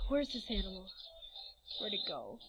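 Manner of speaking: soft, low-pitched wondering aloud; muttering to self. Real, not acted. Vlogger unable to find the animal, quiet low muttering. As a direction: mumble it quietly to yourself. Still searching, talking to self.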